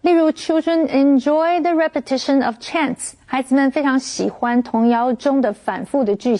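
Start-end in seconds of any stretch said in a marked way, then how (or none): none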